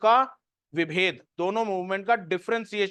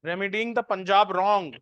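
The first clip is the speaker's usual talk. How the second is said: slow, spaced dictation with long pauses; much slower than usual. Acted. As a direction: dictate each phrase slowly so students can write it down. Dictating notes to students. (धीमी आवाज़ में notes लिखवाना)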